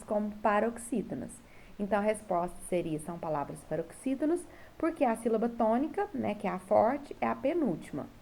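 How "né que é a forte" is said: normal talking voice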